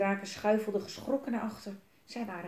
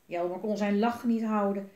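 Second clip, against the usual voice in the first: ordinary delivery, nothing marked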